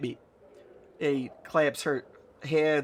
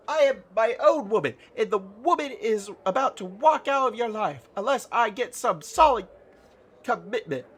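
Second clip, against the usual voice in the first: high, loud indignant tirade; hammed-up outrage, pushing through to the demand; mock furious rant; 6 semitones higher, 4 dB louder than usual. Nanny tells player she'll walk out, high and mock-indignant. Character rants as wronged woman.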